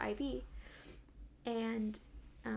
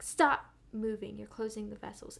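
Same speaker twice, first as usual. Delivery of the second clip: stern order; 8 dB louder than usual. Retold or imitated. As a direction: bark the order like an impatient nurse. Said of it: "she's like".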